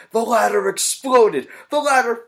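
loud, high-pitched excited yell; re-enacted scream. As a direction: relive the moment, yelling in amazement. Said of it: "I screamed".

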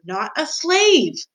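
high, drawn-out emphatic words; slow holler to someone hard of hearing; slow shout. A narrator's reading. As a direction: stretch it out and call it high. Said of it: "shouted the soldier".

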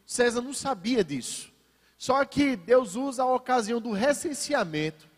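ordinary delivery

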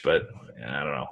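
ordinary delivery

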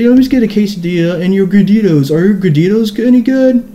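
high mock customer voice; show-off customer. Beanie kid orders as if at an open mic, showing off. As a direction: play the cocky customer, pitched well up. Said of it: "I'm just kinda playing it here".

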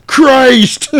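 high, loud startled outburst; hammed-up cry of alarm; mock shocked yell. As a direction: blurt it in panic. Near miss at the cliff.

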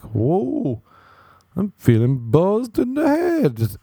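high, slow, drawn-out woozy voice; lightweight drinker. Retold or imitated. Non-drinker reporting a buzz, high and drawn out. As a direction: imitate someone tipsy, stretching it out high. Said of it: "'cause they're like".

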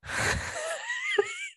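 high, wheezy giggling fit; helpless high cackle, trailing on; embarrassed laughter. Real, not acted. Telling listeners an embarrassing psychic reading, high helpless laughter.

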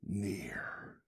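a sudden hushed whisper. Real, not acted. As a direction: breathe the single word, no voice. Preacher whispers the answer.

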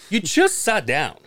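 acted-out fed-up complaint; retold exasperation. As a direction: stretch it out in mock disbelief. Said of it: "I'm starting to get".

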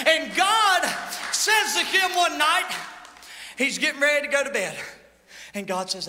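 ordinary delivery

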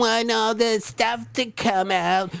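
high, sing-song comic voice; hammed-up character voice. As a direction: ham up a high goofy voice. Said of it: "now that's how you do an impression".

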